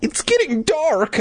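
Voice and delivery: high, wimpy mock voice; whiny little coward. Scared boy tells sister it is late, wimpy whine. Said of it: "I said like a little bitch".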